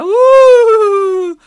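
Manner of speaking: high loud ghostly "ooh". Shown as a demonstration. Explaining the stock ghost noise, loud ghostly ooh. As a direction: demo the stock ghost moan. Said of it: "bog standard".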